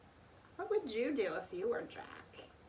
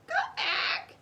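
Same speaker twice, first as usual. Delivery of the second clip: much higher, louder bird cry; parrot squawk; 6 dB louder than usual. A narrator's reading. Polly warns the children, high squawk. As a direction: squawk it shrilly. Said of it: "squawked Polly".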